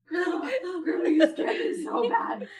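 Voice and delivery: mimicking a frightened grandson; scared kid. Grandson telling Grandma she scared him, high and shaken. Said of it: "He was like".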